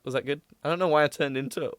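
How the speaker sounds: normal talking voice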